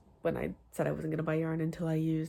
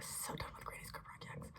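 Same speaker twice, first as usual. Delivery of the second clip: whispering; 14 dB quieter than usual. Real, not acted. Exasperated aside about granny squares.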